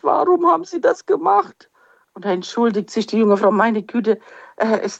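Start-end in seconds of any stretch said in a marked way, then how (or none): 0.0-1.7 a touch of tearful pleading, higher; tearful question. Retold or imitated. Weeping woman asks the young woman why, lightly tearful. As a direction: ask it as a hurt, crying woman. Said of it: "diese weinende Frau sagt".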